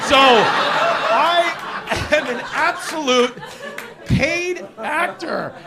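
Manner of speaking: drawn-out, loud, laughing; slow punchline. Real, not acted. Telling the crowd he's a paid Mets fan, drawn out slowly. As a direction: stretch each word out for the punch.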